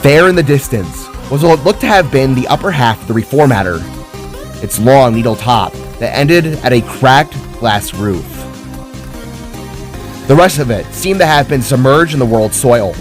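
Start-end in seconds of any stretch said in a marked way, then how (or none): none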